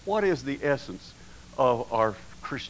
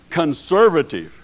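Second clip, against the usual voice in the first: excited answer; 4 semitones higher, 7 dB louder than usual. Retold or imitated. Climax of retold checklist.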